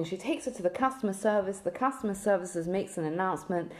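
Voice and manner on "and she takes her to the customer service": usual speaking voice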